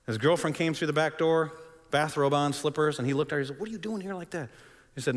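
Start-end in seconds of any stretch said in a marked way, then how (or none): 3.6-4.5 higher, softer puzzled question; retold hushed surprise, pitched up; startled question. Startled question to girlfriend at church.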